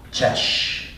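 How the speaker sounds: cześć said as a soft chesh; Polish greeting. Shown as a demonstration. Comedian teaching the audience Polish for what's up, pronounced. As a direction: give cześć the Polish ch and sh sounds. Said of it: "in Polish".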